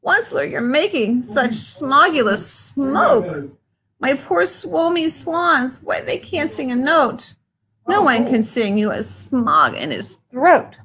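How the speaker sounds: high creature voice, scolding and worried; Lorax voice. Acted. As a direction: fuss at him about the smoke.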